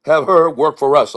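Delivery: lightly put-on older showman praising you; Bud Freeman. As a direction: imitate a boss handing out praise.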